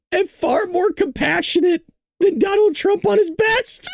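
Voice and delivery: high voice, fighting back laughter; squeaky, barely held-in laughter; laughing while reading. Real, not acted. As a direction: read it out on the edge of breaking up. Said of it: "I'm just laughing into the mic".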